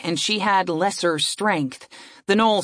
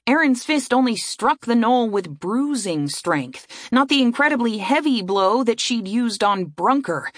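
ordinary delivery

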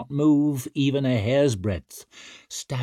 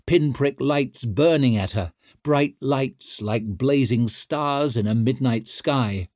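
ordinary delivery, nothing marked

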